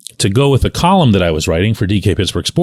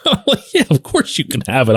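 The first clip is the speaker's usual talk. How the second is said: laughing; 7 semitones higher than usual. Real, not acted. Laughing while retelling.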